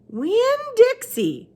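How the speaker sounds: put-on high, very slow old woman; Gloria Dump voice. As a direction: draw the name out slowly, high up.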